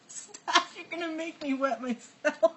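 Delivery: high squeaky words through laughter; helpless laughter. Real, not acted. Begging co-host to stop joking, helplessly laughing. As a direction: plead while cracking up, running out of voice. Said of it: "I can't stop laughing".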